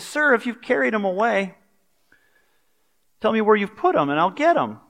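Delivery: mildly voiced desperate Mary; Mary pleading. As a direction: beg him to tell her where.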